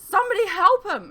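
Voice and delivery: high, very loud shriek for help. Acted. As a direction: scream for help in terror. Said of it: "he shrieked".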